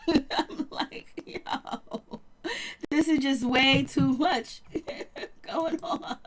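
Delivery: half-breathless words broken by real giggles; laughing in disbelief at the mess. Real, not acted. Overwhelmed by show drama.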